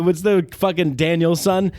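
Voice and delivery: ordinary talk, nothing marked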